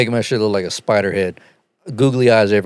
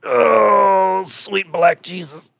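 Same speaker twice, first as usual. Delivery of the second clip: high, slow, drawn-out wail; exaggerated exclamation; 7 semitones higher, much slower than usual. Real, not acted. Host exclaiming at the late hour, drawn out. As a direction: groan it out theatrically.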